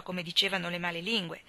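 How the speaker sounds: usual speaking voice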